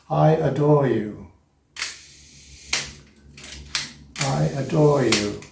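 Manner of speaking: "I adore you" drawn out slowly; measured one-second count. Shown as a demonstration. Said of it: "One second".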